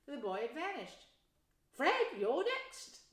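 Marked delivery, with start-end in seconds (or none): 1.7-3.1 high, slow, loud mum calling; drawn-out mother. Acted. Plump woman calling her son next, high and slow. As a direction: call the next boy out slowly. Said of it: "the plump woman said".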